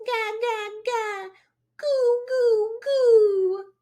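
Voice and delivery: put-on very high cooing baby sounds; baby babble. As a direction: coo like a tiny baby. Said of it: "gurgled and cooed".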